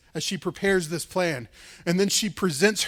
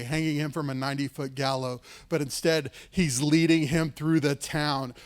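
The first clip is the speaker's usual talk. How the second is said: usual speaking voice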